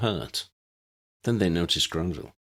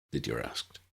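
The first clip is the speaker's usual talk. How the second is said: usual speaking voice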